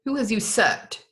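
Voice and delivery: the monk demands to know, crying out; cried out. Acted. Monk to castle men, a slow, loud indignant cry. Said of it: "cried the monk".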